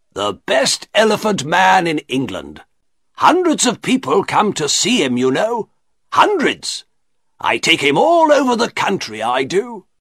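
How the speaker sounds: high-pitched, eager showman's patter; boastful shopkeeper. A narrator's reading. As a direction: boast proudly of your star exhibit.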